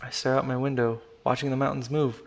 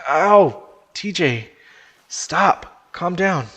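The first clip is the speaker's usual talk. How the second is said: raised, loud urgent protest; pained shout; 5 semitones higher, 8 dB louder, much slower than usual. Acted. Chase clawed by panicking friend in flooding car, pained shout. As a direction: cry out in pain, then order him to stop.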